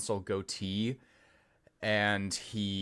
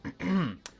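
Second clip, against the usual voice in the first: pointed throat clear; 9 semitones higher than usual. Acted. Cypress interrupts the receptionist, clearing his throat. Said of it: "clears his throat".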